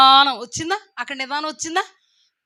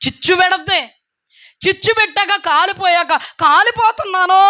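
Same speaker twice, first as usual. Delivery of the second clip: rising, louder preaching call; preaching at full force; 6 semitones higher, 4 dB louder than usual. Acted. Preacher warning the church about fire, rising higher.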